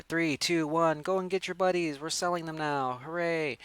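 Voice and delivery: put-on hype voice, held high; store launch announcer. Announcer opening the toy store blowout, with mock cheer.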